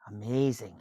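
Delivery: storybook narration, lively and clear; bedtime-book reading voice; reading to a child. A narrator's reading. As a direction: read it aloud to a little one.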